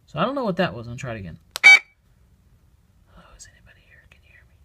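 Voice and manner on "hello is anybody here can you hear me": hushed, barely audible whispered questions. Shown as a demonstration. Investigator trying the question once more on the recorder, whispering. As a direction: whisper softly into the empty room. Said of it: "whispering voice".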